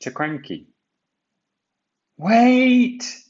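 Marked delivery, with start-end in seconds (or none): 2.2-3.2 high, loud shout of wait; crying out. A narrator's reading. Thomas warning Percy about the ropes, loud cry. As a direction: yell to stop him in time. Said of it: "cried Thomas".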